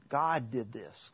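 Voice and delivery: ordinary talk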